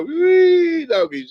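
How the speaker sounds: slow, high, loud wee; whooping wee. Real, not acted. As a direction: holler it out. Exclaiming about the drunk.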